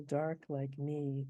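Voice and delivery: "dark like me" slow, dark and me equally weighted; cretic rhythm. Shown as a demonstration. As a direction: hold "dark like me" slowly with even beats. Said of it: "another cretic".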